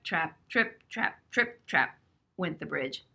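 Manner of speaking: plain reading voice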